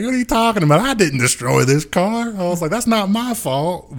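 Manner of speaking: high mock defensive protest; defensive self. Denying he wrecked the car, high mock defensive.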